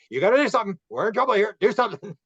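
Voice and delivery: high, loud, urgent alarm; panicked left brain. Retold or imitated. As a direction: sound the alarm in mock panic. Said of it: "the left brain would come back on".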